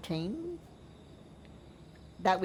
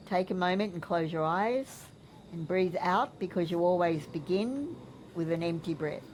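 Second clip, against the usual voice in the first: soft spa whisper; 4 dB quieter than usual. Shown as a demonstration. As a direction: count the breaths gently and evenly. Leads a breathing exercise.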